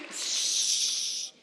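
quick hush; shushing. Shown as a demonstration. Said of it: "faz silêncio".

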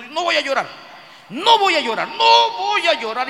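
high, loud, insistent vow; acting strong. Mourner vowing not to cry, loud high insistence. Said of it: "Se pone fuerte".

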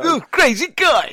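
high, loud, whooping sidekick; hammed-up wacky squeal; mock radio posse. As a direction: imitate a zany radio crew cheering the host. Imitating the radio crew's whooping.

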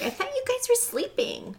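put-on squeaky high girl voice. Daisy walking out to the fire in a high voice. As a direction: pitch it way up like a surprised girl. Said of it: "and she's like".

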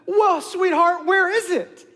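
high, slow, syrupy question; honey-trap a child; hammed-up sweetness. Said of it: "I'm gonna ham this up".

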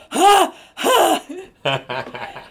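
sudden loud "Ha! Ha!" burst of laughter; loud cackle. Shown as a demonstration. Audience member cackling loudly during a serious scene. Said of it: "really horrific cackle".

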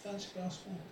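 very soft, mock-polite murmur; understated polite offer. Preacher mocks a tame offer of water, hushed mock politeness. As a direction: offer it quietly, as a mild send-up. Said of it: "It doesn't go".

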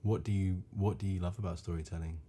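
usual speaking voice

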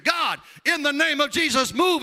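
high, loud shouted prayer; screamed prayer. Retold or imitated. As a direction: cry out the prayer at full volume. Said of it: "He starts screaming".

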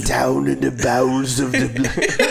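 hammed-up British labourer's accent; Industrial Revolution British. Podcaster answering co-host's joke, Industrial Revolution British accent. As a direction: play an old-time British workman, breaking into laughter. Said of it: "like, Industrial Revolution British".

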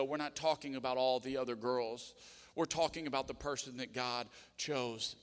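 usual speaking voice

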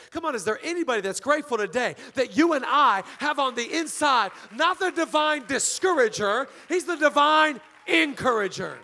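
loud, rising, rousing sermon climax; full-force preaching. Real, not acted. Preacher rousing a grateful congregation, loud and emphatic.

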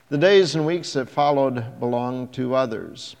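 ordinary delivery, nothing marked

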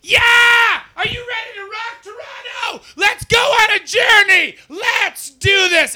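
over-the-top arena shout, stretched and loud; rock star shout. Retold or imitated. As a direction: blast it at the arena like a stadium rocker. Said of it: "he walks out like".

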